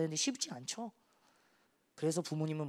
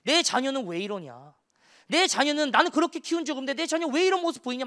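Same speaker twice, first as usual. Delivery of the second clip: rising, louder complaining plea; parents' lament; 7 semitones higher, 8 dB louder than usual. Retold or imitated. Parents complaining to the youth pastor, in a rising lament. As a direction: wail like a worn-out parent. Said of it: "하소연하시면".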